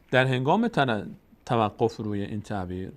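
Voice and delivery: ordinary talk, nothing marked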